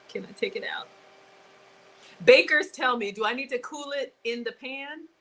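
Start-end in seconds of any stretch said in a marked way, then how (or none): 2.2-3.1 loud call to the bakers. Real, not acted. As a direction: shout out to the bakers for help. Calling out to viewers.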